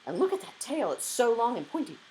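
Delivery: high, loud exclamation of wonder; excited pupil pointing out a sight; excited child. A narrator's reading. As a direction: pipe up eagerly, pitched well up.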